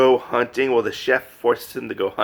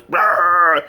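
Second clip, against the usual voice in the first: loud rolled burst imitating lasers; laser blast noise; 4 semitones higher, 4 dB louder than usual. Wolf firing lasers from his mouth, imitated blast.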